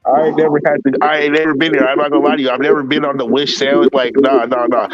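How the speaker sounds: ordinary talk, nothing marked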